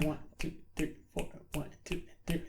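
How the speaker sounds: soft, even count under the strumming; steady counting. Shown as a demonstration. Guitar teacher showing continuous eighth notes, soft steady count. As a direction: count softly and evenly in time. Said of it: "continuous eighth notes going on the whole time".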